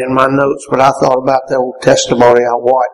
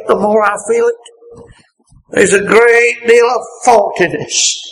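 fervent preaching; 6 semitones higher than usual. Acted. Fervent pleading.

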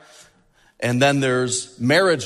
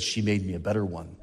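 low, soft reply with a laugh in it; hushed punchline; 9 semitones lower, 10 dB quieter, faster than usual. Retold or imitated. Pastor quietly answering the groom, amused. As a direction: deliver the twist quietly, amused. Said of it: "And he said".

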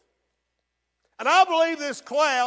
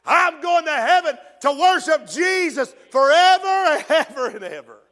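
preaching shout; 4 semitones higher, 6 dB louder than usual. Real, not acted.

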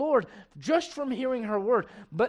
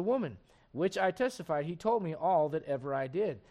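measured King James reading to the congregation; scripture reading; 2 semitones lower, 4 dB quieter than usual. Acted. Pastor reading John four to the church, measured scripture voice. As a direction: read the verses steady and deliberate.

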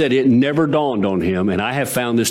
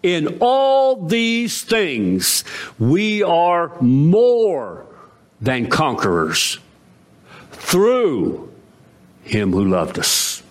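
very slow, word-by-word proclamation; solemn slow reading of the verse; declaimed scripture; 4 semitones higher, much slower than usual. Acted. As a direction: let each word land, slowly.

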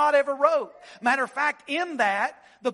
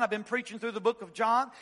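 ordinary talk

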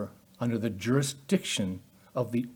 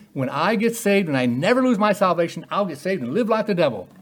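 hammed-up Appalachian twang, higher, louder; half-hearted hillbilly voice, fast at the end; hillbilly accent; 5 semitones higher, 4 dB louder than usual. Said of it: "I sound like a hillbilly when I do that".

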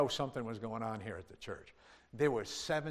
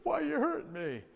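whiny plea, much higher; mock crying man; 8 semitones higher than usual. Slain man waking up, lightly mock whimpering. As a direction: imitate a dazed man whimpering. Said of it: "He woke up crying".

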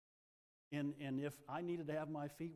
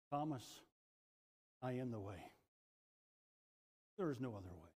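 low, soft and tender to Thomas; caring, loving; 6 semitones lower, 6 dB quieter than usual. Acted. Jesus tenderly telling Thomas the way, soft and loving. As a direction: speak lovingly, gently, as to a son. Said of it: "he said it so caringly and so lovingly".

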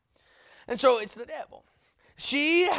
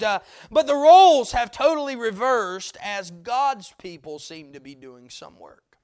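usual speaking voice

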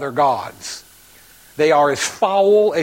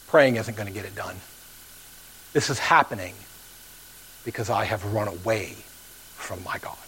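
fading confession; 6 semitones lower, 7 dB quieter than usual. Acted. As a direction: lower and softer until the last words are whispered. Jonah confesses running from God.

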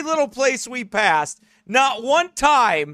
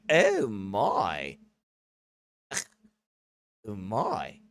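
low, posh British gasp, softer; prim British lady; 12 semitones lower, 8 dB quieter, much slower than usual. Retold or imitated. Offended British lady reacting to Carl's belch, low British gasp. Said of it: "and she's like".

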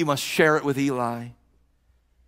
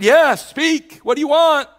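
put-on much higher and louder, a young boy jumping up eagerly; eager boy's reply; 14 semitones higher, 10 dB louder, slower than usual. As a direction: burst out like a boy startled from sleep, eager to answer. Boy Samuel answering eagerly.

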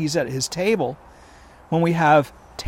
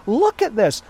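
ordinary talk

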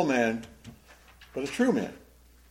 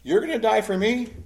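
loud, high-pitched incredulous question; sarcastic; 9 semitones higher, 6 dB louder than usual. Acted. Jesus answering Peter's vow to die, sarcastic. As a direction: question his boast with open irony. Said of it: "Jesus notes the sarcasm".

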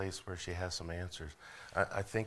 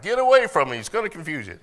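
much higher and louder mock alarmed wife; preacher joking about his wife's warning, in a high mock alarm; imitating wife; 10 semitones higher, 13 dB louder than usual. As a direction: ham up a wife shooing people away. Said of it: "she's like".

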